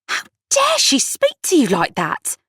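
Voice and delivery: high-pitched outraged indignation; be outraged on your friend's behalf; fuming. A narrator's reading. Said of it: "Kat fumed".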